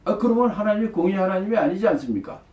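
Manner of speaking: imitated protest, pitched high; arguing. Abraham appeals to God's justice, acted high-pitched arguing. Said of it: "이렇게 따진 거예요".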